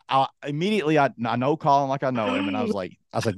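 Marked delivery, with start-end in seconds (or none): none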